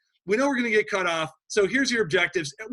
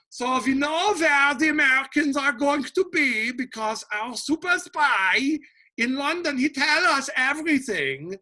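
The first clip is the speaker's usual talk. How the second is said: put-on German accent; 7 semitones higher than usual. Teacher voicing German commanders in a put-on German accent. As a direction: gloat like a German officer with a spy in London. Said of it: "we can talk in a German accent".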